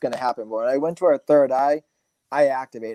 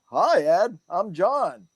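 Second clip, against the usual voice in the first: spirit's greeting; 6 semitones higher, much slower than usual. Retold or imitated. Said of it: "the way you just talked and the way I threw my voice".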